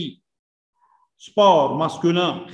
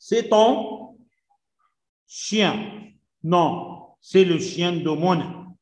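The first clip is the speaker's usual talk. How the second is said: slow reading; much slower than usual. Shown as a demonstration. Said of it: "teacher reads French sentence".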